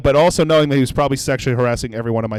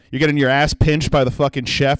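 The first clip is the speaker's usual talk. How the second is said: ordinary delivery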